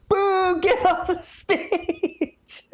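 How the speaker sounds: high-pitched mock holler, last word stretched; drawn-out imitation of a booing fan; retold heckler yell. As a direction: re-enact the heckler for the camera. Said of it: "the guy next to me yells".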